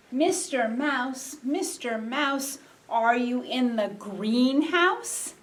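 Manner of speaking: slow, drawn-out game call; playful slow chant, ending on a rising question; sing-song calling. Pretend. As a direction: call out to a hidden mouse, stretching the words. Chanted call to hidden mouse.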